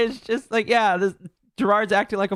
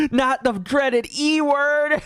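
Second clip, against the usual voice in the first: slow, high mock-dramatic dread through laughter; 8 semitones higher, much slower than usual. Streamer mocking the E word with laughing dread.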